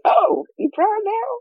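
high, slow, whiny mock outrage; mocking squeal. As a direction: stretch it out in a squeaky teasing wail. Mocking quote to wannabe Africans.

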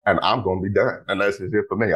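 ordinary talk

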